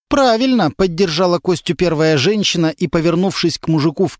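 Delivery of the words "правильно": hearty approval. A narrator's reading. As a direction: back him up, emphatically. Woman cheers him. (женщина одобряет громко, высоко)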